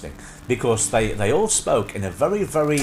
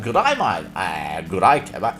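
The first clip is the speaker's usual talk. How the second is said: put-on broad old-soap Australian greeting; hammed-up Australian g'day; Australian accent; 7 semitones lower than usual. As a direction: imitate a very Australian hello. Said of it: "a lot more Australian".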